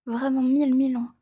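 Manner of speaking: ordinary talk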